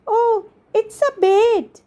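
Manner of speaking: put-on high, slow child voice; a small child naming what she sees, drawn out; high-pitched little daughter; little girl Rini. Child Rini answers mom's question.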